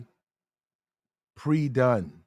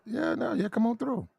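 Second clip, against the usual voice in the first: mock high welcome; 11 semitones higher, 6 dB quieter than usual. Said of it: "be like".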